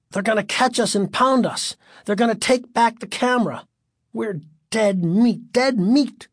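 high, anxious racing thoughts; panicked. A narrator's reading. Panicked thoughts while being chased.

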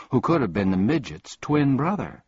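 plain reading voice

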